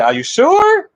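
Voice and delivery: high, loud questioning squeal; doubtful woman. Retold or imitated. Woman questioning her fiancé's promise, high doubtful voice. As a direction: pitch it way up in mock disbelief.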